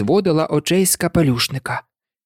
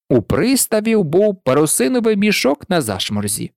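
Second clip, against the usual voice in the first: ordinary delivery, nothing marked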